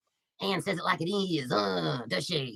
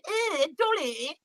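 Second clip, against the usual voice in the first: slow, drawn-out mocking greeting; mock fake lady; 7 semitones higher, much slower than usual. Streamer mocking the fake ladies who greet Dolly, slow mock drawl. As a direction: sneer it like a fake flirt.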